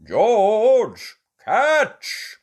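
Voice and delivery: high, loud call. A narrator's reading. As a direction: call across the snow. Daddy calling to George.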